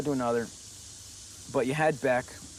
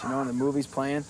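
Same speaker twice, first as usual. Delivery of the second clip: ordinary delivery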